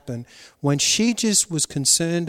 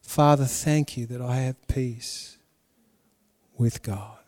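softer, slow and prayerful; softer slow prayer to the Father; 6 semitones lower, 7 dB quieter than usual. Shown as a demonstration. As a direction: pray it gently, slowly. Modelling a prayer.

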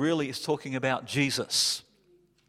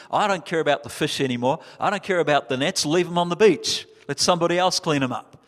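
fast, louder, carefree disciple; eager; 3 semitones higher, 4 dB louder, faster than usual. Retold or imitated. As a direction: toss it off, leaving the nets. Disciple leaving the nets behind.

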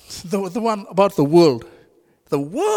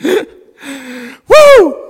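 laughing whoop; 4 semitones higher, 8 dB louder than usual. Real, not acted. Laughing outburst mid sermon.

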